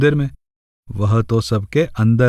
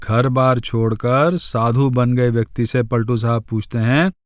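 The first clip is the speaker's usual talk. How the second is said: ordinary talk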